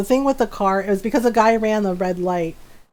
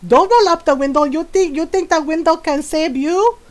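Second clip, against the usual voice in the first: mock furious yell; 6 semitones higher than usual. Mom yelling at the driver rolling up his window, high angry threat. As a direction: yell it at him through the closing window. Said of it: "my mom is yelling at him".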